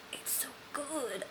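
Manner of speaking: a soft whispered gush. Real, not acted. Admiring the Anthropologie line to viewers, whispered. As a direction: breathe it out like a secret. Gushing over pricey decor line.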